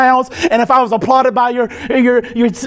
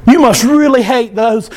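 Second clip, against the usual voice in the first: usual speaking voice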